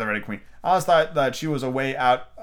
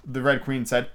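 plain reading voice

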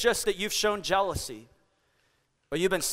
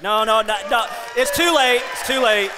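mock shout; 4 dB louder than usual. Teasing audience for missed applause.

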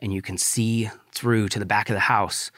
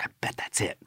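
hushed unvoiced whisper to himself; whispered guess; 12 dB quieter, faster than usual. Sneaking to the house, whispering his hunch.

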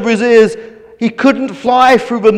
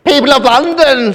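a hint of a gloating villain, higher; 5 semitones higher than usual. A narrator's reading. The Hooded Nose addressing London, lightly voiced villain. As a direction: announce grandly as the villain. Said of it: "the unmistakable voice of the Hooded Nose".

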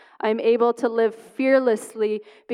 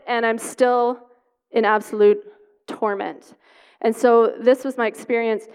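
normal talking voice

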